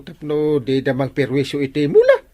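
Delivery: ordinary talk, nothing marked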